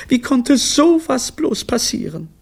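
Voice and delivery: very high wail of disbelief; dismayed wife's high-pitched cry; aghast. Acted. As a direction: lament it, stunned and high.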